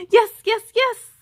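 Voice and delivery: high, loud eager yeses; boy thrilled at the invitation, enthusiastic yes. A narrator's reading. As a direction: bounce with eagerness. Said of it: "said B J enthusiastically".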